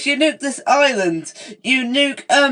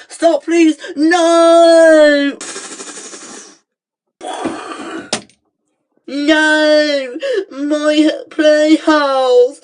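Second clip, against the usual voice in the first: despairing wail; 5 semitones higher, much slower than usual. Acted. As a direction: cry out as everything is destroyed. Villain wailing over losses.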